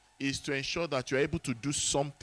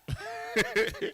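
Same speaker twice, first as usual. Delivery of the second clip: a high-pitched burst of laughter. Real, not acted. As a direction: laugh high at your own joke. Jokes about crying in secret.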